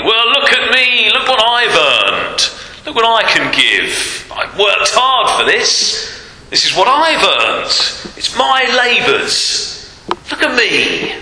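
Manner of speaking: high, slow, self-satisfied bragging voice; proud boastful man. Acted. Preacher mocking a proud giver, high boastful brag. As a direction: puff yourself up and show off your earnings. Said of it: "That's the attitude of man".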